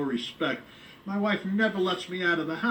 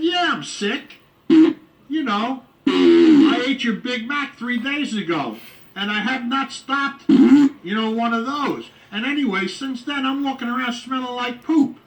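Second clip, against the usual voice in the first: indignant complaint; 6 semitones higher, 8 dB louder than usual. Retold or imitated. Complaining to McDonald's girl.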